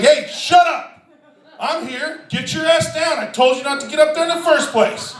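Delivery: high-pitched exasperated telling-off; mock scolding. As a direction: act out an annoyed dad scolding. Dad scolds the calling child.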